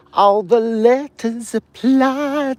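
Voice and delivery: high, slow grand declaration; showman voice. Pretend. Vlogger continuing his mock proclamation, high and slow. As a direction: proclaim it like a herald.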